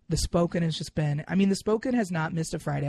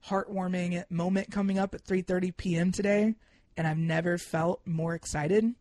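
ordinary delivery

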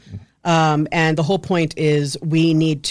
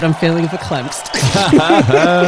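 mock Linda Richman; 4 dB louder, slower than usual. Linda Richman catchphrase as guest.

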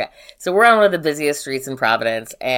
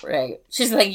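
normal talking voice

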